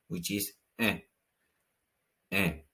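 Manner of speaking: en said as a nasal French vowel, no n closure; en, open nasal vowel. Shown as a demonstration. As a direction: en through the nose. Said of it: "another vowel sound, which is E N".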